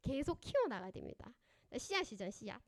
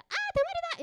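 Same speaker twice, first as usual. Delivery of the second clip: put-on much higher, loud excited child shout; child blurting out; 7 dB louder than usual. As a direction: blurt it like a kid spotting something funny. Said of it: "이 말이 나오잖아요". (아이가 신나서 외치듯 높고 크게)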